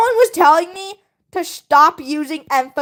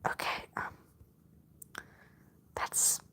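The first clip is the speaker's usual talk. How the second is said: soft unvoiced whisper; hushed whisper; 23 dB quieter than usual. Real, not acted. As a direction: breathe it out under your breath. Sudden whisper.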